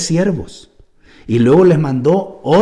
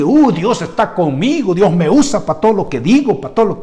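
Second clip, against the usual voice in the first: smug pastor; 6 semitones higher, 3 dB louder than usual. Retold or imitated. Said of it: "creen que".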